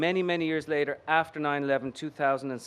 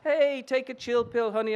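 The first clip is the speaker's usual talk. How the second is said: higher, sassy brush-off; Bronx hooker; 6 semitones higher than usual. Acted. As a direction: tease him like a streetwise hooker. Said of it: "in the voice of a thirty-eight-year-old hooker".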